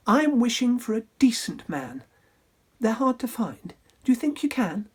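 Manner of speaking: wistful and wary; a hint of weary heroine; Cindy voice. Cindy speaks to fairy.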